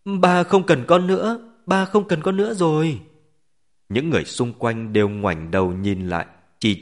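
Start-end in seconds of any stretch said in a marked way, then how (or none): 0.0-3.0 put-on high, loud tearful child's wail; crying little girl. A narrator's reading. Little girl thinks Daddy doesn't want her, crying. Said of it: "vừa khóc vừa nói".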